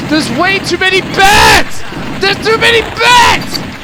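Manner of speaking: overwhelmed gamer shouting at the screen; panicked shout. Real, not acted. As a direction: yell in alarm as the bats swarm. Swarmed by bats in game.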